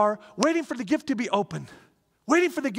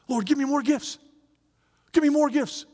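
eager prayer; 8 semitones higher, 3 dB louder than usual. Pretend. Praying a pleading prayer.

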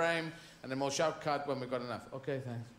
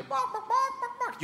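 high barked words like a small dog; yappy dog barking. Pretend. Barking like a dog to answer the man. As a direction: bark back like a dog.

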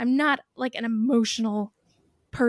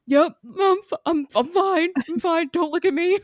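higher, louder, stammered fake fine; retold near-tears brush-off, pitched up; barely holding it together; 6 semitones higher, 4 dB louder than usual. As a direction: act out insisting you're fine while about to lose it. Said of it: "about to lose it".

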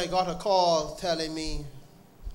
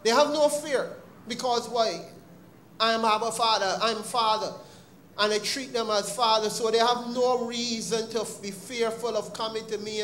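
higher, louder preaching build; preaching at full force; 5 semitones higher, 4 dB louder than usual. Acted. Preacher to congregation on fatherly love, higher and louder. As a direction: drive it home to the congregation.

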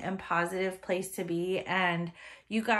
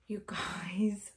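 breathy awed whisper to the viewers; hushed, delighted whisper; 3 semitones lower, 7 dB quieter than usual. Real, not acted. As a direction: whisper it like a secret.

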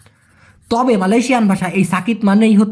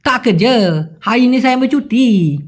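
higher, slow Malaysian slang with stretched endings; Malaysian slang reply; 4 semitones higher than usual. Retold or imitated. Worker replying about leave, in drawn-out Malaysian slang. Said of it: "আমি জবাবে কি বলেছিলাম?".

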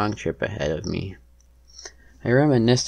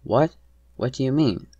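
higher, alarmed question; pitched-up startled questioning; startled disbelief; 5 semitones higher, 4 dB louder than usual. A narrator's reading. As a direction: ask it in shock. Wife reacts to hijacking news.

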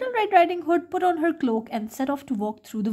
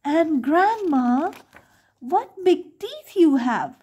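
slow wary question; 5 semitones higher, 4 dB louder, slower than usual. A narrator's reading.

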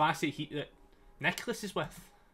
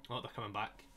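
ordinary delivery

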